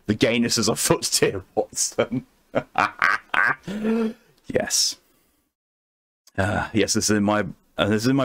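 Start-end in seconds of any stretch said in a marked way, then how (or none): 0.1-2.2 put-on a touch of a posh Sherlock Holmes English accent; mock Sherlock accent.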